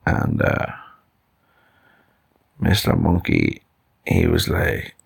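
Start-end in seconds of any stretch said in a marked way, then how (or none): none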